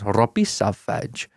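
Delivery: slow put-on Welsh accent. Shown as a demonstration. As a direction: demonstrate a Welsh accent, drawn out. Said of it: "So, like, if it's Welsh".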